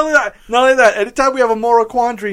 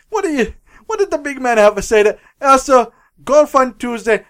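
hammed-up Italian Pope pleading on the phone; mock Italian accent breaking into laughter; bad Italian accent; 2 semitones higher than usual. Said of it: "stereotypical bad Italian accent".

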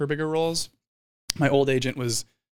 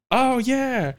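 mock surprised; 12 semitones higher than usual. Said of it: "I was like".

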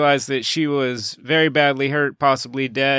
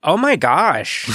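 put-on American accent; 3 semitones higher, 4 dB louder, slower than usual. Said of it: "his American accent".

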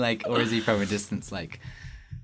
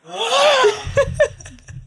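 high loud passing screech with Doppler; tie fighter doppler; 11 dB louder than usual. Shown as a demonstration. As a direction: scream past like a fighter ship. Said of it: "I have to do a tie Fighter impression".

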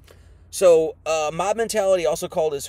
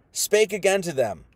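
ordinary delivery

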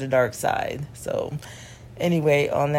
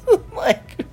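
laughing; 12 semitones higher, 6 dB louder than usual. Real, not acted. Laughing while repeating the quote.

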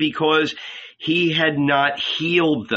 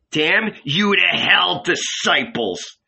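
slow, drawn-out curse, pitched up at first; mild angry curse; 6 semitones higher, slower than usual. A narrator's reading. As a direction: deliver a measured, mildly angry damnation. Simon quotes a curse at disciples.

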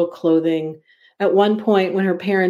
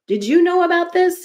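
yelling; 11 semitones higher than usual. A narrator's reading. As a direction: yell the question out. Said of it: "He is yelling".

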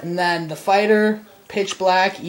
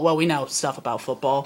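ordinary delivery

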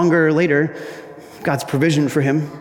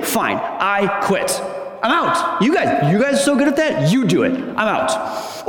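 huffy quitting; 3 semitones higher than usual. Pretend. Quitting in a huff at critics.

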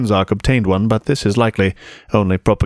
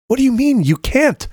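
put-on much higher, demanding girl. A narrator's reading. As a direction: demand it, pitched high. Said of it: "Shai demanded".

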